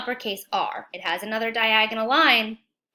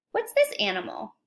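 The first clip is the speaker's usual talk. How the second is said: ordinary delivery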